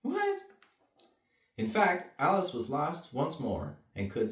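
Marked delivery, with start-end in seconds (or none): 0.0-0.4 very high startled what; surprised what. Real, not acted. Reader startled by the picture, very high. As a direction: yelp it in surprise.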